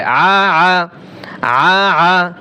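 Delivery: guttural ain আ with the mouth wide open; ain pressed in throat. Shown as a demonstration. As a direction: squeeze আ from inside the throat. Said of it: "গলার ভিতরে আইন চাপিয়ে বলতে হয়".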